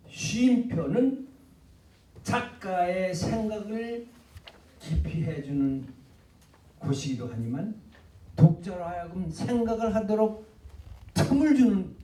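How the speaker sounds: very slow, weighty delivery; a lecturer stretching words slowly for weight; slow emphasis. Real, not acted. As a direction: draw each phrase out to make the point sink in.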